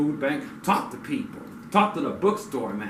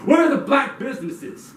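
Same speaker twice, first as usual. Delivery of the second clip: indignant demand; 11 semitones higher, 6 dB louder than usual. Real, not acted. Angry rhetorical demand.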